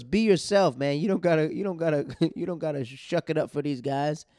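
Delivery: insistent mock shout at the performer; retold heckling. Heckler answers back in retold quarrel.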